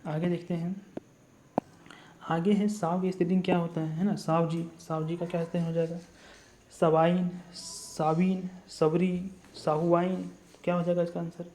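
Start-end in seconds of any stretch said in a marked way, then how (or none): none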